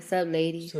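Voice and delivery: low, soft flirty voice; lightly voiced smooth talker; sexy voice. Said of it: "He just put on a sexy voice".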